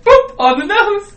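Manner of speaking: very high, loud, slow sing-song; cutesy boop. Retold or imitated. Man booping the crocodile, cutesy and very high. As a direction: coo it like talking to a puppy. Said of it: "the second one was like".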